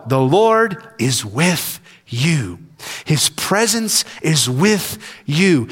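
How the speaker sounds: the Lord is with you, said tenderly and slowly; tender reassurance. Real, not acted. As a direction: gently assure the weary listener. Comforting the anxious and exhausted.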